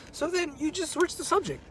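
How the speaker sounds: ordinary delivery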